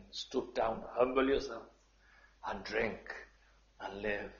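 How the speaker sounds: ordinary delivery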